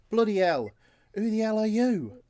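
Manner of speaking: loud, high British shout of alarm; thick British accent. Acted. GM voices the waking prisoner startled at the group, thick British accent. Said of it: "that thick British accent comes through".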